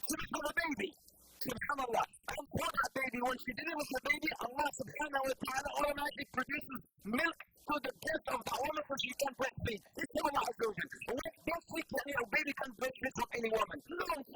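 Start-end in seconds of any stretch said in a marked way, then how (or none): none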